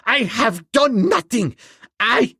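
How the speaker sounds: high, desperate pleading, breaking off; high panicked pleading; frightened plea. Acted. Schneider pleading, cut off.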